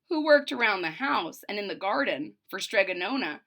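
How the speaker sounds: ordinary delivery, nothing marked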